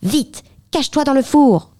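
high, hurried warning; urgent cry. A narrator's reading. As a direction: hurry the boy into hiding. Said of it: "s'écria la géante".

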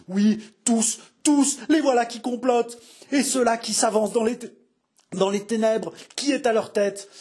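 high, strained paranoid frenzy; delirious raving. A narrator's reading. King seeing plotters in the dark, hysterical raving. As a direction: challenge imagined killers, voice climbing wildly. King hallucinates murderers in a fit.

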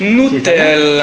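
"Nutella" drawn out slowly as noo-TEL-la; echoed pronunciation. Shown as a demonstration. Trying out the friend's correction, slow "Nutella" with oo. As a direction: stretch "Nutella", long oo in the first syllable. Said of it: "Non, on dit Nutella".

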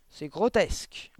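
loud, drawn-out disgusted bark. A narrator's reading. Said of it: "aboya mademoiselle Le Gourdin". (aboiement indigné)

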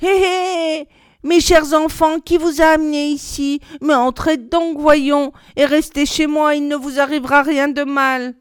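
put-on high, sugary crone voice luring children in; sweet old witch. A narrator's reading. Old witch coaxing the children into her house, high and sugary. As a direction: coax them inside like a honeyed old hag. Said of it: "la vieille branla la tête et dit".

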